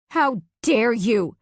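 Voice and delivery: slow, outraged shout at her husband; wife screaming in fury at him. Acted. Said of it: "I scream".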